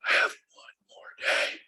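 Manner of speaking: slow, breathless whisper; imitating dad's whisper; whispered repeat. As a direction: let the words fade to a dying whisper. Dying dad repeating himself.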